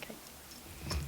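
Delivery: breathy whisper, barely there; soft unvoiced whisper; whispered okay. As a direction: whisper it close to his ear. Soft aside while grooming him.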